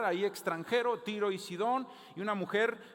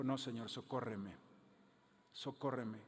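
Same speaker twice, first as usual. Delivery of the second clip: humble soft plea; 6 semitones lower, 7 dB quieter than usual. Retold or imitated. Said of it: "Y dijo".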